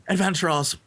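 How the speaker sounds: rally them like a badly acted hero; silly hero voice. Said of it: "has the silliest voice".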